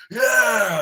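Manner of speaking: high, drawn-out mock "yeah"; imitated triumphant yell. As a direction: mimic a wrestler's roar of approval, moderately loud. Acting out wrestler's triumphant reaction.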